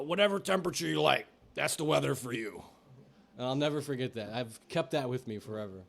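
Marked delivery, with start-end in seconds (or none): 0.0-2.6 imitating a customer's catchphrase, raised voice; quoting an old regular in a put-on voice; Jerry. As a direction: put on the regular's voice for his famous line. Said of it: "his famous quote from Jerry was".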